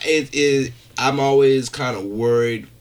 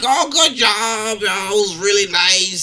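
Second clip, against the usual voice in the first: put-on slow, higher old-person voice; a hammed-up doddering compliment; imitating old folks; 6 semitones higher, slower than usual. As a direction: mimic the elderly fans praising him. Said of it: "Dude, they really sound like that?".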